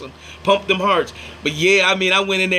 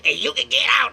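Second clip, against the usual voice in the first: imitated lady; 6 semitones higher than usual. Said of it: "the lady was like".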